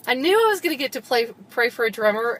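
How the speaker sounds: gasp, then quick, high delight; excited realization. Retold or imitated. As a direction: catch your breath and burst out with it. Retells delighted reaction to news.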